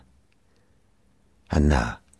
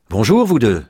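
high, louder morning hello; cheerful greeting; 6 semitones higher, 7 dB louder than usual. A narrator's reading. Mother greets husband and son on a lovely morning, cheerfully. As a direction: greet them brightly, pitch lifted.

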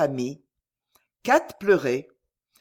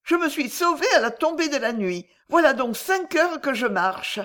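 put-on distraught young girl; 7 semitones higher than usual. A narrator's reading. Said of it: "s'écria Lydie".

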